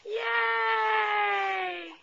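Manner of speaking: delighted high cheer; excited cheer. Real, not acted. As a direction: cheer him on. Cheering baby.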